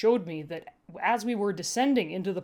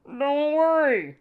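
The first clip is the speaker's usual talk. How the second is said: higher, slow, put-on voice for Cad; a higher villain voice; Cad's voice; 6 semitones higher, 5 dB louder, slower than usual. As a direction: voice Cad, soothing and false. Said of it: "cad said".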